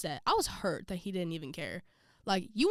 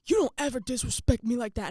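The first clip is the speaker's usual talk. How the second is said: louder, higher imitation of offended coach; angry coach; 4 semitones higher, 5 dB louder than usual. Coach scolding player on bench, angry. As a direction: bark it like an affronted coach. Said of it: "he was like".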